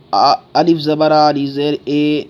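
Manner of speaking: "الف زیر اے" ending on ay instead of ee; wrong Urdu-style reading. Shown as a demonstration. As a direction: demonstrate the mistake, "اے" as an open ay. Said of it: "اور ایسے بھی نہیں پڑھنا".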